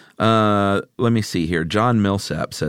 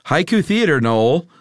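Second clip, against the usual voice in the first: announcer voice; 7 semitones higher, 3 dB louder than usual. Acted. Announces the show segment.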